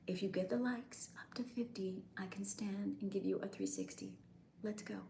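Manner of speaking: hushed whisper trailing off; a sly whispered plea; mock whisper. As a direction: drop to a breathy whisper. Mock pitch to viewers, trailing off.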